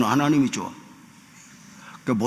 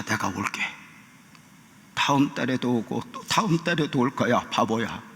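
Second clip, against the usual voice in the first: quiet mock-scolding through welling tears; tearful pastor, retold; 2 semitones lower, 4 dB quieter than usual. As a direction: scold tenderly, understated and tearful. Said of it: "눈물이 그렁그렁 하면서".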